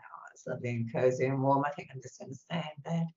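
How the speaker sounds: soft, low, snug morning voice; quietly acted reluctance to get up; cosy waking murmur. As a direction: murmur it as if still snuggled in bed. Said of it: "I wake up in the morning and I'm like".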